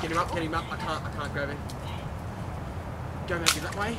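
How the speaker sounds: soft, strained urging; hushed urging. Real, not acted. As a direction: keep it low while fighting the weight. Straining quietly during the catch.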